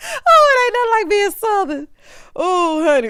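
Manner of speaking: loud laughing cry of delight. Real, not acted. Laughing about being Southern.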